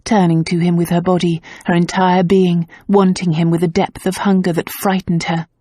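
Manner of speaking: ordinary talk, nothing marked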